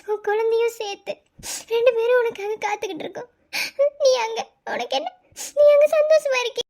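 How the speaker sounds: crying as she complains; tearful. Acted. Lonely wife to husband away in the US, tearful. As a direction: reproach him through sobs, with halting pauses. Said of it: "இப்ப ஏன் அழுக?".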